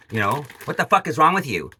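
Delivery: ordinary delivery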